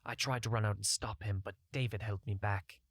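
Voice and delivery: ordinary delivery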